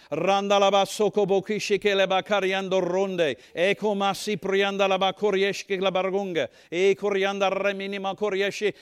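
fervent praying in tongues; speaking in tongues. Shown as a demonstration. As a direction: pour out tongues like a prayer under pressure. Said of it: "what do you do when the pressure comes".

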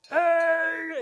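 very high, loud, drawn-out holler; imitated scream. Proud grandma screaming from the stands at her grandkids, piercing yell. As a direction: holler it like the loudest voice in the stadium. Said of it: "she would be screaming".

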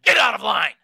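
sudden high, loud mock yell; retold scream. Man screams at woman who cut in line, imitated yell. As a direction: imitate an exploding man shouting at her back. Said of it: "He screams at the back of her head".

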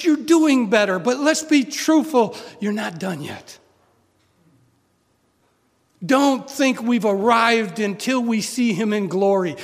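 loud, driving sermon voice, pushing every line; full-force preaching. Acted. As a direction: preach it hard to the congregation.

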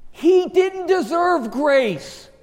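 high, slow, ringing proclamation; emphatic preaching. Real, not acted. Preacher to congregation on the thief, emphatic preaching. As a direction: stretch it out from the pulpit. Preacher drives the point home.

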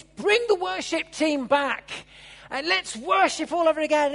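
crowd's rejoicing after the healing; joyful call. Acted. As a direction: call it out like a jubilant worshipper.

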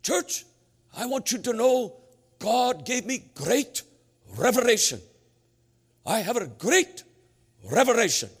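high, loud Norwegian preacher impression; imitated Norwegian brother, halting and emphatic; Norwegian accent. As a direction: announce it grandly in a thick Norwegian accent. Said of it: "and he said".

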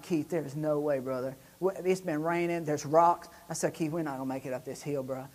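low, soft protest; subdued doubt. Retold or imitated. Passenger tells Keith the hill can't be climbed, low subdued doubt. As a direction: drop the voice and reason with him. Retells his doubt to driver.

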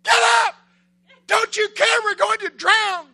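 high, full-out scream; panicked screaming. Acted. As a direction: scream for help like terrified disciples in a storm. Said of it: "they scream at Jesus".

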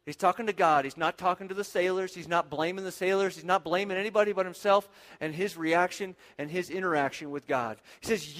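ordinary talk, nothing marked

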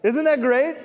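high, loud delighted exclamation; excited. Real, not acted. Preacher thrilled by a quote he showed the church, excited high exclamation. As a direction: burst out with pure delight at the quote.

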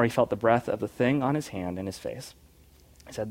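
ordinary delivery, nothing marked

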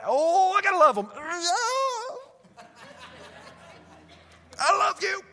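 high, loud, put-on sweet voice; hammed-up forced love, way up high; fake-nice churchgoer. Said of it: "Hold on, here they come".

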